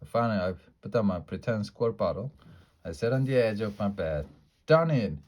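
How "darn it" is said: frustrated. A narrator's reading. Frustrated child exclaiming.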